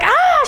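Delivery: sudden high shriek of fright; mock scream. Friend sees a lizard in the hotel room, shrieking. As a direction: shriek like you've just seen a lizard. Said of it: "She's, like, freaking out".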